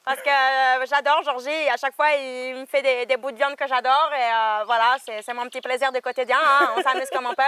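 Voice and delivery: hearty southern cook like Maïté, speaking from the throat; Maïté southern accent. Acted. Invited cook names dinner guests in a southern accent. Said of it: "Comme Maïté de la cuisine des mousquetaires".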